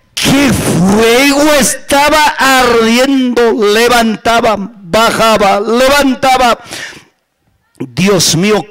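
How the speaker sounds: ordinary talk, nothing marked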